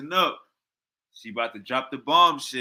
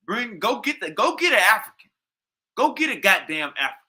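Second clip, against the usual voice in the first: louder, higher ranting demand; fired-up, raised-voice demand; heated demand; 6 semitones higher, 5 dB louder than usual. Real, not acted. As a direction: shout it like a challenge. Heated rant demanding sources.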